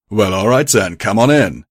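loud guard calling folks in; shouted welcome. Acted. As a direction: shout it down to the travellers. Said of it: "he shouted".

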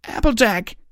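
loud, high desperate scream; anguished scream. A narrator's reading. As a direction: scream her name in horror. Friend falls, cries out.

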